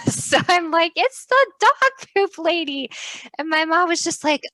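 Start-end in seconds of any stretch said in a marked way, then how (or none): 0.9-2.9 higher, slow gleeful announcement; excited, amused reveal. Retold or imitated. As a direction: point her out like you caught her. Telling mom at window.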